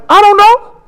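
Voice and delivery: very high, squeaky-pitched shrug; high rising comic aside; playful cluelessness. Acted. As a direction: toss it up high, mock clueless. Preacher playfully answers own question.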